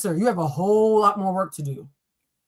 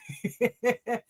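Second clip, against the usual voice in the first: high giggle; 6 dB quieter than usual. Real, not acted. Bursts out laughing.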